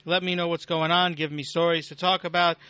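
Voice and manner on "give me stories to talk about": usual speaking voice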